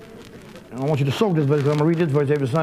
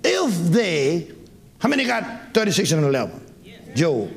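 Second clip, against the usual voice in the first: ordinary talk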